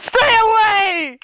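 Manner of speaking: high screaming stay away; panicked yell. Real, not acted. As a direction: scream to keep him off. Giant chasing him.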